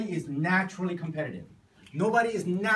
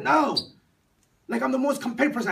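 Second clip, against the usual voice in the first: high, loud, fast protest; defiant student; 8 semitones higher, 7 dB louder, faster than usual. Retold or imitated. Student refuses to sit down, defiant and loud. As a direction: refuse loudly, then rush out the boast. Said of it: "He's like".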